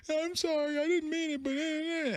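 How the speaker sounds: high, soft mock sobbing apology; imitated crying. Dad imitates his daughter apologizing, tearful whimper. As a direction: whimper it like a child in tears. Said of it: "stopped crying".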